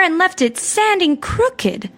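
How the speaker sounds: high, slow mother-bear voice. A narrator's reading. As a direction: draw it out as mama bear. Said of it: "said the mother bear".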